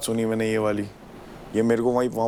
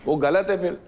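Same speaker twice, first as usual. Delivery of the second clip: much higher, insistent raised voice; heated argument; 8 semitones higher than usual. Real, not acted. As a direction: hammer the point home angrily. (तीखी बहस में ऊँची आवाज़ में ज़ोर देना)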